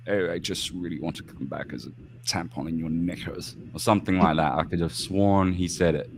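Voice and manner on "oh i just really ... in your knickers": softly imitated poncy English accent; mock a poncy little royal accent, softer. Host quoting King Charles to the guests, mock poncy accent. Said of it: "in his poncy little accent".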